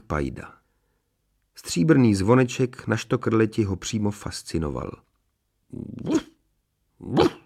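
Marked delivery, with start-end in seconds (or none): 5.7-7.4 small soft imitated woofs; dog barking. A narrator's reading. Dachshund at a strange bell, softly imitated barking. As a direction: do short, muffled dachshund woofs. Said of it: "zaštěkal na tu neobyčejnou věc".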